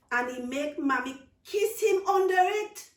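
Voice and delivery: put-on loud, high outraged child; indignant child. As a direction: blurt out the injustice.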